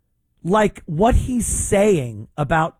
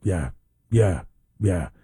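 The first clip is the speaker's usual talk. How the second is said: low, lightly voiced mumbling yeahs; mock De Niro mumble; 9 semitones lower than usual. Host mimicking De Niro agreeing with Amy, low mumbled yeahs. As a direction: lightly grunt them like a mumbling old actor. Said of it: "says nothing but".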